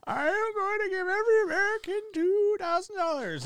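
very high, strained caricature of a politician; Joe Biden. Shown as a demonstration. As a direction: proclaim it in a high put-on presidential voice. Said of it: "this is my best Joe Biden accent".

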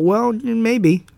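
voices a younger self's hesitant "Well, maybe" with a jump up in pitch; mock-hesitant inner voice of a kid; doubtful "maybe". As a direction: shrug it off with a lifted, unsure "maybe". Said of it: "I thought".

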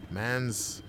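usual speaking voice

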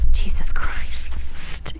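voiceless, long-drawn exasperation; exasperated whisper. Real, not acted. Reader tripping over words again, whispered in exasperation. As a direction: stretch it out slowly under the breath.

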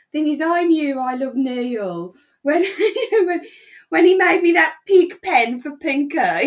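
quoting tipsy Susan, giggling; laughing through quote. Retold or imitated. Friend quotes tipsy Susan's pig pen story, laughing. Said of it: "she says".

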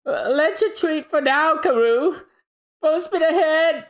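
high panicky comic voice; high frantic sidekick fleeing. Acted. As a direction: squeal it out in a high, frantic cartoon voice. Voicing Usopp fleeing on duck.

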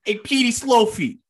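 put-on high, loud old-timey New York gangster call; 1930s gangster accent. Shown as a demonstration. Showing his cohost how to do the gangster accent. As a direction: belt out the nickname like a Roaring Twenties mobster. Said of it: "Can you say it with the accent?".

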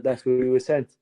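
ordinary talk, nothing marked

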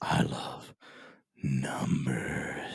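low, breathy whisper on NUMBERS; hammed-up, drawn-out love confession; exaggerated odd delivery. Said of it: "why'd you say it like that".

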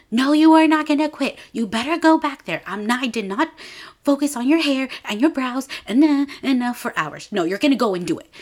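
high, loud mock scolding; hammed-up angry stylist. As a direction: chew her out for quitting. [name] scolds Viola not to quit.

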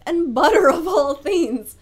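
loud, disbelieving burst; exasperated outburst. Real, not acted. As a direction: throw up your hands at it. Exasperated rant peak.

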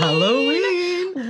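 high, slow drawn-out spooky call. Pretend. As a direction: stretch it out like a ghost. Echoes the holiday name spookily.

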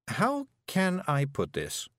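slow, pondering, pitched slightly up; musing. A narrator's reading. Miss Level looking for tactful words, musing. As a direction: feel slowly for tactful words. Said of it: "Miss Level mused".

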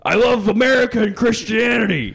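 shouting Alex Jones. Pretend. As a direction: bellow it like a patriotic rant.

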